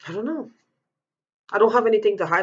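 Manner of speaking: ordinary talk, nothing marked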